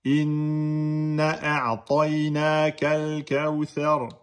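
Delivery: Inna a'tayna kal kawthar chanted slowly, nn held; slow Quran recitation. Shown as a demonstration. Teacher demonstrating a verse, slow chanted recitation. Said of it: "Par exemple".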